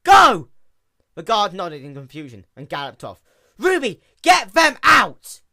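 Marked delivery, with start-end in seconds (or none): 0.0-0.5 loud, high urgent command; shouting at the guard to hurry off. Acted. Guard urgently called away. 3.6-5.4 loud, high, drawn-out yell; panicked princess yelling to Ruby for help; frantic shout. Acted.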